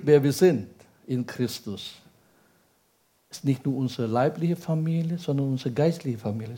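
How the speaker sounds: ordinary delivery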